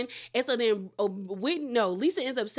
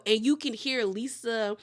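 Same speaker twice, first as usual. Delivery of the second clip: normal talking voice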